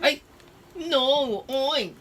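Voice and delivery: high, squealing no and oink; playful squeal. Acted. Dad playfully corrects, squeaky.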